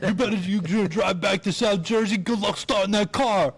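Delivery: lightly hammed-up mobster warning; mock tough-guy threat. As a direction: threaten like the bombing comic, in jest. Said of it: "retelling the comic's threat".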